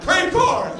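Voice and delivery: high, loud mock cadence yell; screamed. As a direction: scream it like a runner's chant. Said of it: "scream about corn".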